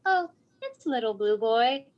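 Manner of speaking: a hint of a small child's high voice; light toddler voice; lightly voiced little girl. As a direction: answer sweetly like a toddler. Daughter voiced in story.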